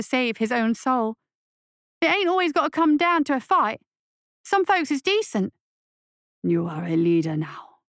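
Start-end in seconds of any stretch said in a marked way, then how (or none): 2.0-5.5 put-on higher, urgent young voice; scared boy. A narrator's reading. Boy pleads with monster. 6.4-7.8 monster voice. A narrator's reading. Monster repeats lesson.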